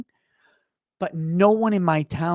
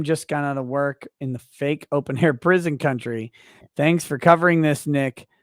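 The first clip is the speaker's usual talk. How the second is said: normal talking voice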